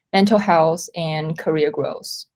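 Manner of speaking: ordinary delivery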